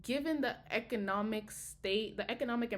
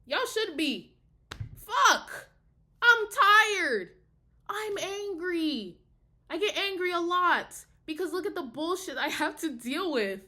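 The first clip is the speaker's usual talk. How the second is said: high, loud venting, fed up; tired and angry, voice raised high; real angry outburst; 7 semitones higher, 5 dB louder than usual. Real, not acted. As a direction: let exhausted anger burst out.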